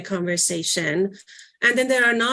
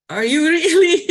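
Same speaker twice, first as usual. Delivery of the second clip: incredulous; 8 semitones higher, 7 dB louder than usual. Retold or imitated. Mocking a teen's apology.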